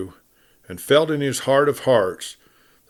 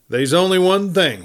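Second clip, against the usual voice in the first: sheriff's voice; 7 semitones higher, 4 dB louder than usual. A narrator's reading. Sheriff telling the gents one last thing, firm. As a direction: state it plainly, lawman to outlaws.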